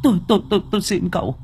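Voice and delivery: high, tearful stammering plea; desperate begging. Acted. Wife begging the monk not to approach her husband. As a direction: beg in terror. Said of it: "van lạy Tâm Trúc".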